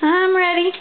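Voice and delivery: high put-on doggy voice; high pretend pet voice; voicing the dog. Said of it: "He says".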